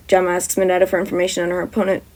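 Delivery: ordinary delivery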